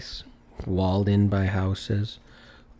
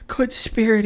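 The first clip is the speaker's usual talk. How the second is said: much higher, imploring address; pleading; 5 dB louder than usual. Acted. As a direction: appeal to the spirit's kindness. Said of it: "he pursued".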